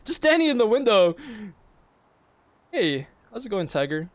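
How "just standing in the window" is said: excited. Real, not acted. Caster excited at player sighting.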